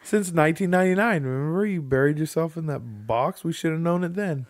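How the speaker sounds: high, loud, gushing fake fan; mock starstruck fan. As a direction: gush at him like a giddy fan who just figured it out. Mock fan addressing David Blaine.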